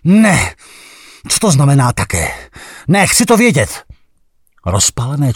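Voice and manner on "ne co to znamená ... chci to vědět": high, loud frantic demands; jealous outcry. Acted. The inner savage shouts at R, jealous outcry.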